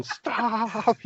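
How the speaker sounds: high-pitched, drawn-out mock scream. Retelling the aunt screaming after a runaway child, mock scream. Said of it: "She's just screaming".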